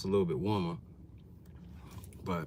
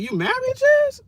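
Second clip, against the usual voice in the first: squeaky high-pitched shock, voice jumping way up; he's floored that she's married; shocked disbelief; 11 dB louder, slower than usual. Retold or imitated. To viewers, retelling his reaction to Jasmine: shrill disbelieving squeak. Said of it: "I'm like".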